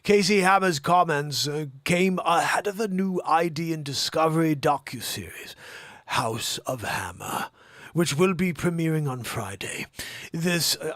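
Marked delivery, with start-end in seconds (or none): 0.0-9.9 put-on loud, measured English accent; Jeremy Irons English accent. Host announces docuseries in put-on English accent. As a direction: deliver it like an English actor. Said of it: "My English accent is essentially Jeremy Irons".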